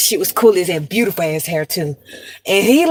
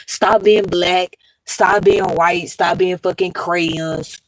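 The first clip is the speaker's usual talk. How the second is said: each word laid down slowly; drawn-out, hammering the point; slow, emphatic; much slower than usual. Real, not acted. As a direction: spell it out slowly for them. Slow emphatic preaching to viewers.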